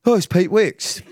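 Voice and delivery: high-pitched mock kid voice; kid recognising someone. One of the kids recognises the man outside, high mocking call. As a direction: imitate a kid spotting a celebrity. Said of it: "one of them's gone".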